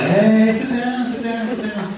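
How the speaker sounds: lightly voiced chummy hairstylist; Raul's greeting. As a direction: play the overly friendly stylist. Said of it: "And he's like".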